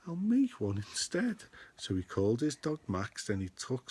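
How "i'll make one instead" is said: suddenly high, pleased Grinch; squeaky Grinch announcing his plan. Acted. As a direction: pitch it up like a scheming Grinch. Grinch voiced line.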